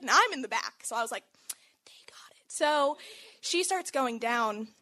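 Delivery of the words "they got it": anxious whispered reassurance. Retold or imitated. At the back of the line, whispering nervously. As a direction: whisper it under your breath.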